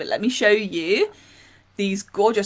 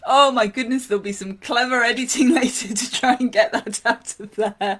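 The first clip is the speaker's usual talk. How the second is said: laughing loudly at herself; 3 semitones higher, 6 dB louder than usual. Real, not acted. Laughing at own sneeze.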